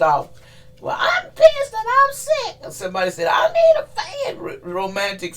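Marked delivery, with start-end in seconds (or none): 0.8-2.6 high, slow, furious put-on voice with a wail; a wailing angry spirit yelling; high mock-crying rant. Voicing an angry message. 3.3-4.4 high put-on voice with a cry; high mock wail. Voicing overheated message.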